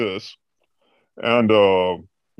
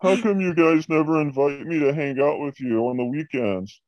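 high, plaintive mock voice; higher caricature of a left-out director; imitating needy boss; 5 semitones higher than usual. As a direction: whine it like a lonely boss. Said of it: "He'd always be like".

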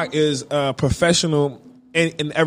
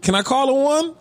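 Snoop; 9 semitones higher than usual. Retold or imitated. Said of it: "And he was like".

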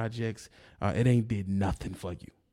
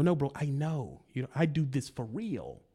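usual speaking voice

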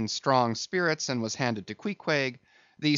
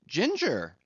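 high, incredulous repeating of a word; measured, mounting disbelief in a raised voice; slowly incredulous; 8 semitones higher than usual. A narrator's reading. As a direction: say it in pointed disbelief. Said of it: "slowly saying".

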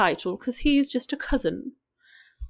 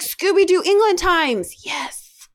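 excited gush; 9 semitones higher, 3 dB louder than usual. Retold or imitated. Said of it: "I was like".

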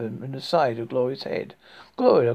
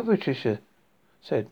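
ordinary delivery, nothing marked

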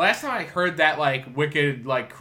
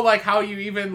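ordinary delivery

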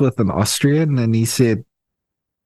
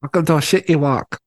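mock Jackie Chan; 6 semitones higher, faster than usual. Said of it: "how does Jackie Chan sound".

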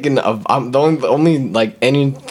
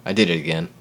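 ordinary delivery

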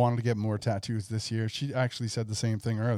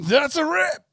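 mock outro call; 6 dB louder, slower than usual. Mock show-closing sting.

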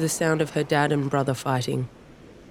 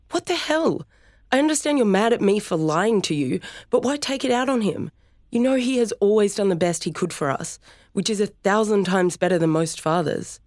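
high, incredulous anger; angry rebuke; 6 semitones higher than usual. Acted. Sister turning on her brother after dad leaves, angry and high. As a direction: round on him furiously.

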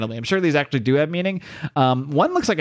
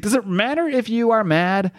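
higher, emphatic god's retort; lightly voiced god posing a rhetorical question, pitched up; Khonshu voice; 8 semitones higher than usual. God Khonshu answering a doubting Mark, higher emphatic retort. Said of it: "Khonshu says".

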